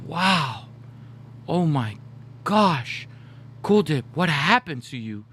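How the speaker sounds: mock amazement, word by word; Samoan auntie. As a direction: gasp out shock like a Samoan auntie. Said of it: "my Samoan auntie, was like".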